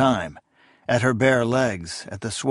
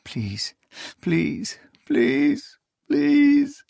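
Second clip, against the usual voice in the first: sobbing plea; much slower than usual. A narrator's reading. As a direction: sob the pleas, rising to a cry. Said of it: "The first sobs racked her".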